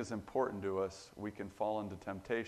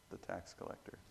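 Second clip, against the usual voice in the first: almost whispered, much softer; hushed repeat; 9 dB quieter than usual. Real, not acted. Preacher echoing the answer to his congregation, hushed. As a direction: let the answer sink in quietly.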